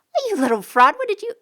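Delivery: loud, high shocked exclamation; shocked outburst. A narrator's reading. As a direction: explode at the little fraud.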